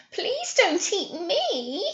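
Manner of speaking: high, slow, giggly little squeak. A narrator's reading. Baby goat begging the troll, high and squeaky. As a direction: plead in a tiny squeak. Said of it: "he squeaked".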